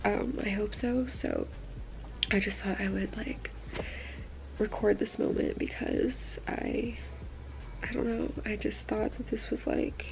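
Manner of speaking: hushed, emotional whisper, close to tears. Real, not acted. Whispering to viewers about moving west, emotional. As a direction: confide a dream in a near-whisper.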